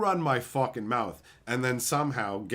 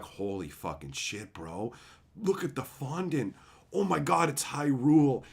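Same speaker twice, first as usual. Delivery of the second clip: usual speaking voice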